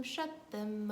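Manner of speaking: ordinary talk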